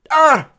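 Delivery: loud high-pitched scream; short villainess yell when struck; sudden piercing cry. A narrator's reading. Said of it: "Medusa yelled".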